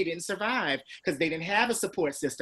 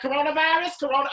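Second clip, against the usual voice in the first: excited exclaim; 10 semitones higher, 4 dB louder, faster than usual. Retold or imitated. Announcing her positive test.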